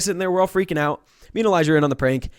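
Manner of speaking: ordinary delivery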